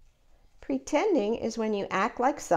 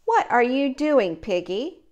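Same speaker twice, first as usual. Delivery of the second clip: ordinary delivery, nothing marked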